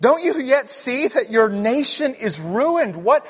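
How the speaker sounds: high, urgent pleading counselors; pleading servants. Retold or imitated. Servants begging Pharaoh to let the people go, urgent. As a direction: beg the king to give in, voice climbing. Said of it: "his servants come to him and say".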